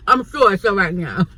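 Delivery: amused, laughing through the words; real laughing. Real, not acted. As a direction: crack up while saying it. Laughs at her retold exchange.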